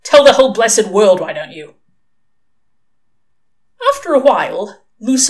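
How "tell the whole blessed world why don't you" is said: loud sarcastic outburst, falling away at the end; exasperated and loud at the start; snapped. A narrator's reading. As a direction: snap back with irritated sarcasm. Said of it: "Crowley snapped".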